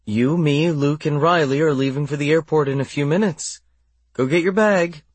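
high, firm motherly voice; firm but gentle. Acted. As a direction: set her straight kindly. Casey firmly corrects child.

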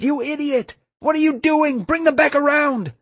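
very high, loud furious shriek; shrill-high, outraged shouting; angry cry. A narrator's reading. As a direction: scream at someone who is ruining everything. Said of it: "Ashley cried".

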